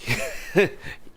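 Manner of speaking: a quick breathy giggle; airy giggle. Real, not acted. Gamer giggling between repeats of easy, airy. Laughing after the kill.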